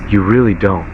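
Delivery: ordinary delivery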